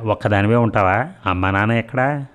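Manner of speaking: usual speaking voice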